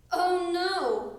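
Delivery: high, loud dismayed cry; cried out. A narrator's reading. Froggy remembering his sleeping bag, crying out. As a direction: wail it in sudden dismay. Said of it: "cried Froggy".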